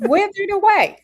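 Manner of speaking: high, loud amazed question; marveling. Acted. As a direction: let astonishment push the voice way up. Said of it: "they marveled, saying".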